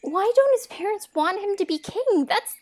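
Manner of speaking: loud, indignant disbelief; appalled. Acted. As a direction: react aghast at the news. Outraged on hearing the parents' plot.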